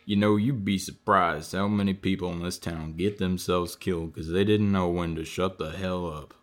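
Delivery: low, controlled menace; menacing threat. Acted. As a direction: drop the pitch and warn them off. Veiled death threat to the questioner.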